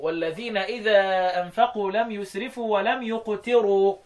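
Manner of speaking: ordinary delivery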